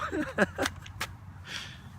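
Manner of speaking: airy giggle at her own act; quick high laugh; high breathy laugh. Real, not acted. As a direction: let a light laugh slip out. Laughs after mock crying.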